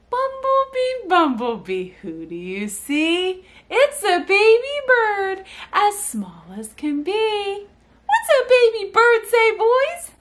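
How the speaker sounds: high, sing-song reading to babies; animated story reading. Shown as a demonstration. Mother reads the Bumblebee book to her boys, animated sing-song. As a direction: chant it with big repeated swoops, stretching the words. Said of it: "the way I do it".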